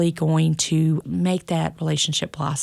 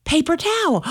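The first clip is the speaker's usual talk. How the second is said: put-on higher child's voice, pleased with his answer; the little son offering his idea, pitched up; proud small child; 11 semitones higher than usual.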